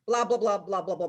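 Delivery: mock gibberish reply, tumbling downward in pitch; incomprehensible speech. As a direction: mimic talk you can't understand. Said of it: "to me, it will sound".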